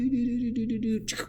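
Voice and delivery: high, loud rapid doo-doo run; goofy high-pitched sound effect; connecting noise. Acted. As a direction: imitate whirring connections with quick syllables. Imitating connections being made.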